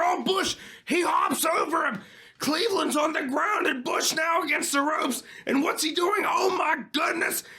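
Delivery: excited shout. Acted. Big aerial move in match.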